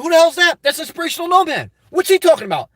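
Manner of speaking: high-pitched mock bewilderment; puzzled crowd reaction. Crowd asking about Inspirational Nomad, animated puzzlement. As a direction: act out onlookers buzzing about a newcomer.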